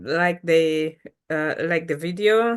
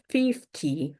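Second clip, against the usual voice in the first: normal talking voice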